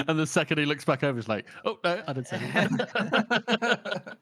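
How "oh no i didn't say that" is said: high, slow put-on innocent voice; mock innocent denial. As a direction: play dumb, drawn out. Caught out, quick denial.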